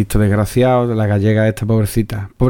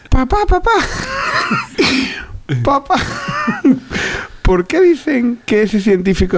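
put-on very high child's voice with a Galician lilt; child voice, Galician touch. Host voicing a child asking his dad, very high with a Galician lilt. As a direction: play a whiny Galician kid. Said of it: "Me sale acento gallego ahora".

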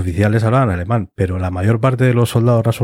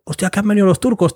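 panicked exclamation; 10 semitones higher than usual. Retold or imitated. Late troops panicking.

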